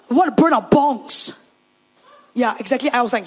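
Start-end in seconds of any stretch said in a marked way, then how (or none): 0.1-1.4 loud, blunt announcement; put-on boxer voice, a bit loud; announcing boxer. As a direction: declare it proudly to the pastor. Boxer announces plan to pastor.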